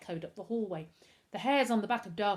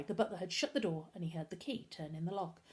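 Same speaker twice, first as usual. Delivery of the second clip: plain reading voice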